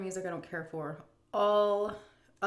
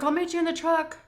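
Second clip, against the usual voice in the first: higher, louder mock husband call; husband calling to wife, higher and louder; 11 semitones higher, 9 dB louder than usual. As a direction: call it out across the house. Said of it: "He's like".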